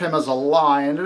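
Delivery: ordinary delivery, nothing marked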